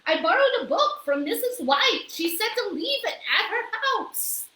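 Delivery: high, bright-eyed character voice; the maid proudly explaining to the mayor, high and loud; Amelia Bedelia. A narrator's reading. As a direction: say it high and cheerful. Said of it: "said Amelia Bedelia".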